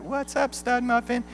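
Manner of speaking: put-on high, soft girlish coo. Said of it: "And she's like".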